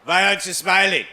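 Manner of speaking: cheeky taunt. Retold or imitated. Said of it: "I was like".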